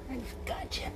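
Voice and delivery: soft unvoiced whisper to a pet; hushed reassurance. Real, not acted. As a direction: breathe it gently. Soothing the dog, whispering.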